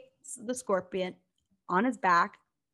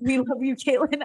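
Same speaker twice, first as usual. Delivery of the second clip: higher and louder, calling out love for Caitlin; excited shout-out; 5 semitones higher, 7 dB louder than usual. Real, not acted. Host calling out to listener Caitlin, excited and loud. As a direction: beam it out to Caitlin.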